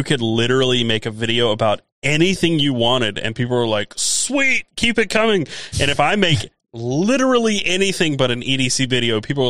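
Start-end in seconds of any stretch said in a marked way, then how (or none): 4.0-5.4 eager fan voice. Retold or imitated. Mimicking viewers' praise.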